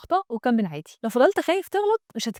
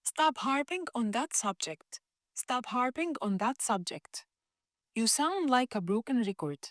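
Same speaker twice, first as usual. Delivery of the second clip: very slow and softer practice line; slow soft model; 9 dB quieter, much slower than usual. Shown as a demonstration. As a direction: lay it out slowly for copying. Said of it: "عليك الدور إنك أنت تجرب". (جملة للتدريب ببطء وبهدوء)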